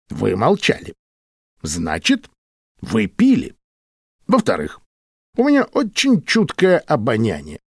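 measured reasoning with swooping pitch; deliberate, sing-song argument with pauses; lightly voiced Walker. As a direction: lay out each point separately, pitch jumping.

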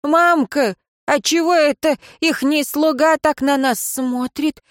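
put-on high girlish voice; high-pitched, naive village girl; young girl's question. A narrator's reading. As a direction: ask it high, like a curious girl. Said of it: "тихо спросила девица".

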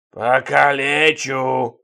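loud, long drawn-out roar; roaring threat. A narrator's reading. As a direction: bellow the word, stretching it right out. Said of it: "заревел дядя Володя".

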